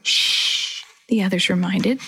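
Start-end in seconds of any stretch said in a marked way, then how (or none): 0.0-0.8 long voiceless shush; hushing shh. A narrator's reading. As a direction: shush them firmly. Others hush the squealing girl.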